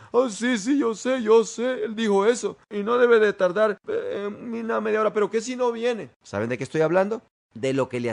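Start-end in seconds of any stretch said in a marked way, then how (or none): 0.1-6.1 high, loud, worried babble; mock fretting. Doubter waits for the pastor's cheque, mock-anxious fretting. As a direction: act out someone anxiously reassuring himself.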